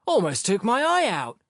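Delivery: high-pitched gloomy complaint. A narrator's reading. Sharikov complaining about his eye, gloomy. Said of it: "Sharikov responded gloomily".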